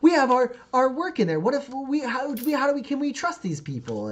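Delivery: high, loud, frantic protest; panicked accusing shout; upset shouting. Acted. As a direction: yell at the neighbours who let strangers in. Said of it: "you can kinda hear her".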